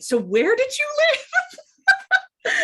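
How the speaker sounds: very high, drawn-out playful question; mock interrogation. Throwing people off by asking, high and drawn-out. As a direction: stretch it out in a high, teasing squeal. Acts out her constant question to strangers.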